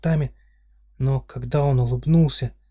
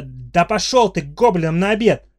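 high, loud angry outburst; snapping; 6 semitones higher, 6 dB louder than usual. Acted. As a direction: lose it and fling the curse at him. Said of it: "сорвалась".

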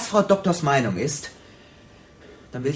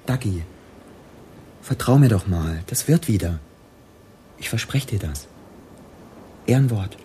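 soothing reassurance; 6 semitones lower than usual. Acted. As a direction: win her back gently. Gunman soothes girlfriend.

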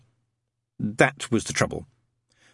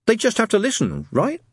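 higher, lightly voiced boy guessing; hazarding; 12 semitones higher than usual. A narrator's reading. Boy answers his friend's challenge, hazarding a guess. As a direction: venture it, seeking agreement. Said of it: "hazarded Adam".

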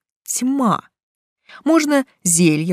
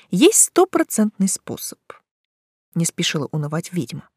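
plain reading voice

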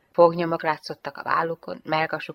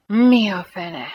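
slow, loud sigh of dismay; sighed exclamation; 3 semitones higher, 7 dB louder, much slower than usual. A narrator's reading. Startled lieutenant in Jane's embrace, sighing. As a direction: heave it out, drawn out. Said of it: "sóhajtotta".

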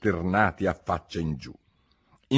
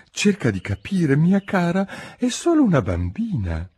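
timid plea; 10 semitones higher than usual. Acted. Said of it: "disse timidamente".

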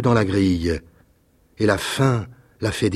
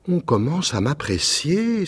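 joyful exclamation; 7 semitones higher, slower than usual. A narrator's reading. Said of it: "s'écria-t-il".

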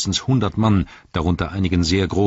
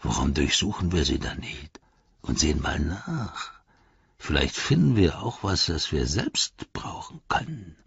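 softer, scheming orc, whispered at the end; sly orc; 4 dB quieter than usual. A narrator's reading. Orc suggesting they search the captives, sly. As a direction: whisper the greedy idea.

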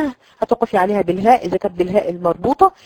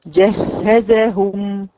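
جهزهم very slowly, doubled ha held; slow demo; much slower than usual. Shown as a demonstration. Teacher recites جهزهم slowly for students. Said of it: "أنا بقرأ طبعًا ببطء".

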